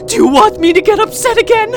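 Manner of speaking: high, loud, tearful threats; crying as the warnings build toward a threat; rising menace. Acted. Caretaker warning her captive pet what happens when she's upset, tearful menace.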